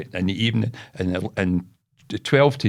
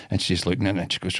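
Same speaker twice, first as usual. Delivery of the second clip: ordinary talk, nothing marked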